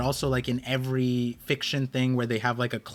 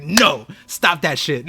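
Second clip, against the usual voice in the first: slow, clipped nurse voice scolding; mock stern nurse; 5 dB louder, slower than usual. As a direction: play the nurse shutting you down, slowly. Voicing the nurse while sedating her.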